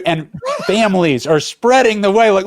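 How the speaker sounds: ordinary talk, nothing marked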